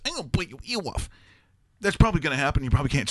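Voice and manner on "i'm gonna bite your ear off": high-pitched boxer impression; Mike Tyson. Boxer decides to bite his opponent's ear, mimicked high voice. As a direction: put on a much higher voice, playing the freak in the ring. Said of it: "and Mike Tyson decides".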